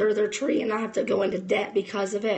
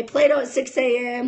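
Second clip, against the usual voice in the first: ordinary talk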